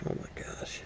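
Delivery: breathless whispered oh my gosh; whispered exclamation. Real, not acted. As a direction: whisper it in dismay. Oil dripping on him.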